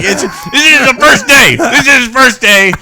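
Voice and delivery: put-on high, loud, slow kid shout; kid's excited answer. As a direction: yell like the proud little boy. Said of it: "And I'm like".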